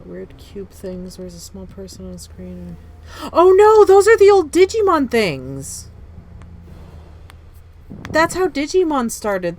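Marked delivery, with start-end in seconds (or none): 3.3-9.6 high, loud sudden realization; excited shout. Real, not acted. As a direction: blurt it out, thrilled. Sudden realization about Digimon.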